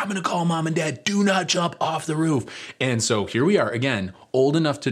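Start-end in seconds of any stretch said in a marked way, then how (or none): 0.0-2.4 high-pitched mock yell of warning; high scolding yell, not at full volume; imitated yelling. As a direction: imitate a sister yelling up a threat. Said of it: "she's yelling up".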